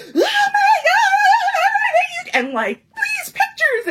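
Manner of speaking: very high, loud, stretched squeal; mock the fans squealing over pictures; gushing goth fangirl. Mocking goth fangirls at the signing table, shrill stretched squeal. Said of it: "angsty goth girls with arms full of Zim shit".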